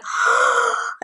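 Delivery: sharp high gasp of shock; overwhelmed gasp. Acted. Teacher miming a learner overwhelmed by options. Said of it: "It's like".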